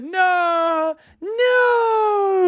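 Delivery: very high, loud drawn-out "no"; mock wail. Reacting to a comment on Gerrard, high mock wail. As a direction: wail it in hammed-up protest.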